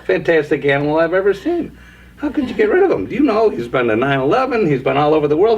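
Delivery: high-pitched, incredulous joke character; acted amazement. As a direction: play an awestruck customer, voice climbing high. Acting amazed customer in joke.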